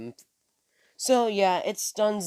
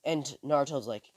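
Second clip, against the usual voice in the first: ordinary talk, nothing marked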